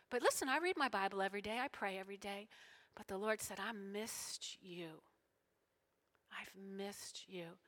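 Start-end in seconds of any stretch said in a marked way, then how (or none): 3.6-7.6 very soft, drawn-out near whisper; tender, hushed. Retold or imitated. Speaker relays the Lord's words, tender and hushed. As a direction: let each word sink in, slow and hushed. Voicing the Lord speaking to her.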